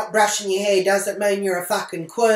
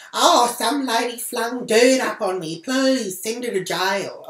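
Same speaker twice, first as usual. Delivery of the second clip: higher, louder whiny mock victim; mock whiny complainer; 6 semitones higher, 6 dB louder, slower than usual. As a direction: whine like a petty complainer. Mocking woman at police.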